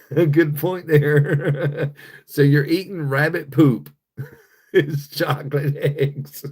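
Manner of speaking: talking through laughs, slow; real laughter. Real, not acted. Host amused by a viewer's chocolate egg joke, laughing. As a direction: crack up between the words. Cracking up over rabbit poop joke.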